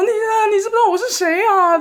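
very high, loud desperate pleading; frantic mom. Retold or imitated. Mom at the bedside begging her son to know her, very high. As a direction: beg like a mother losing it. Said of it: "那我妈真的是疯掉欸".

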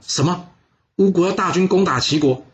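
put-on an old minister can't believe his ears; shocked disbelief.

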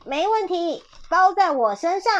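high, emphatic eager promise; storybook dog volunteering boldly; confident boast. A narrator's reading. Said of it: "小狗爱管事拍着胸说".

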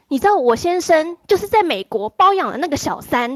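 wife on the phone venting about her husband, loud and high; angry retort. Retold or imitated. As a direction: fire it back like an outraged wife. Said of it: "他就很生气的跟我说".

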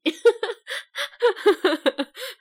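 high rising laugh; bright rising giggle. Real, not acted. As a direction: burst out laughing. Laughing at own remark.

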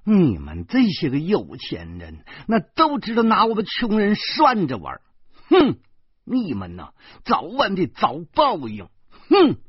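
bitter curse with high, loud huffs; gritted-teeth resentment. A narrator's reading. Job-seeker cursing rich people, bitterly angry. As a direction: spit it out through gritted teeth. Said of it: "她咬牙切齿地说".

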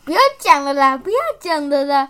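loud, giggly stop-it plea; laughing protest. Real, not acted. Child begging mom to stop teasing, loud and giggly.

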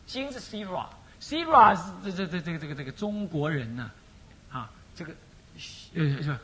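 normal talking voice

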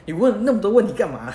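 annoyed friend. Retold or imitated. Friend fed up with endless questions, mock angry outburst. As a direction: snap back, fed up with the questions. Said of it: "他就很不爽".

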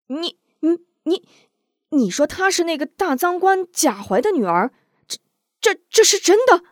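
high-pitched, stammering astonishment; stammer, reeling from the revelation; shocked disbelief. A narrator's reading. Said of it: "倒把韩平惊得险些坐地". (惊得结结巴巴)

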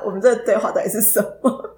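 usual speaking voice

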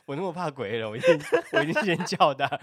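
talking through laughter; laughing. Real, not acted. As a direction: giggle between words. Explaining answer while laughing.

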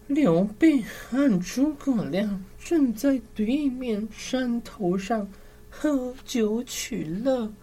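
high, slow, urgent soldier report; a breathless soldier reporting in a high voice; whisper yelling. Acted. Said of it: "这时士兵报告说".

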